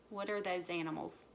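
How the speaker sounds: ordinary delivery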